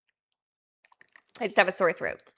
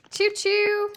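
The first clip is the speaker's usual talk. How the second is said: high, loud choo choo, all aboard; train conductor call; 8 semitones higher, 5 dB louder than usual. Acted.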